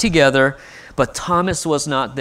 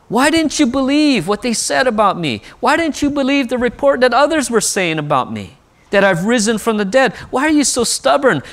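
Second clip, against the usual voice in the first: mock exasperated rebuke; 7 semitones higher than usual. Pastor voicing an imagined Jesus berating Thomas, high exasperated scolding. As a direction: throw up your hands at his stubbornness. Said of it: "He doesn't say".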